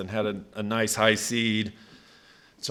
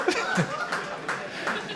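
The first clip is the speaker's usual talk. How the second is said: voiceless wheezing laughter; real helpless laughter, no voice; breathless laugh. Real, not acted. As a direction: silent shaking laugh. Laughing at own story.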